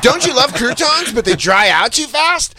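high, singsong mock presenter with a slow big reveal; corny wedding-speech pitch. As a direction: ham it up like a scripted duo's introduction. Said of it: "They always have corny wedding speech energy".

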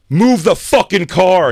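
loud, high mock cop yell; imitated yell. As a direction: bellow the order slowly as an officer would. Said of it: "The guy yelled".

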